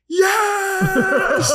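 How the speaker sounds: high, laughing shout of joy; cheering shout. Retold or imitated. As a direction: cheer like the whole village. Village cheering when power returns.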